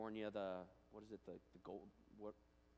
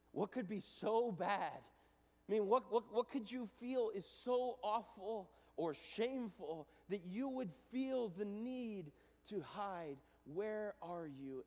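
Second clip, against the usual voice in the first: pleading God; 10 semitones higher, 7 dB louder, slower than usual. Acted. God voiced tenderly but intense.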